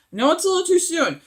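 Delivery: normal talking voice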